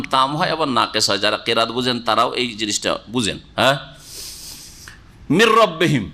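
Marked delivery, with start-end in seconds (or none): 5.3-6.0 মির রব্বিহিম with the n wholly merged into a doubled r; মির রব্বিহিম, no trace of n; full merging. Shown as a demonstration. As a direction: roll straight into a held r in মির রব্বিহিম. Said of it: "রায়ে ঢুকিয়ে দিয়েছেন".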